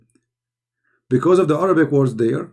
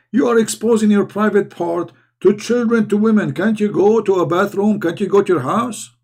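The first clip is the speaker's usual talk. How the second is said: raised, outraged voice; indignant; 5 semitones higher than usual. Real, not acted. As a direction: scold them in disbelief. Indignant rant about public urination.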